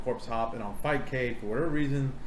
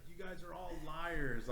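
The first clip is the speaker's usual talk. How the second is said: soft accusation; 3 semitones higher, 17 dB quieter than usual. Retold or imitated. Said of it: "Like, like".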